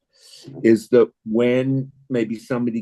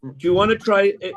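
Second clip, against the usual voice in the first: ordinary talk, nothing marked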